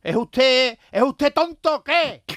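high, loud, exasperated soldier; annoyed soldier. Retold or imitated. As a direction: scold him like a fool, high and loud. Soldier snapping at general.

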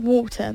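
"water" with a posh long vowel and no final R; slow, posh British "water"; posh British pronunciation. Shown as a demonstration. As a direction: say "water" as WAW-tuh, T sounded. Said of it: "eso se lo diría los posh".

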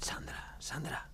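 soft unvoiced calling whisper. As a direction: hiss her name to share a secret. Sharing a secret quietly.

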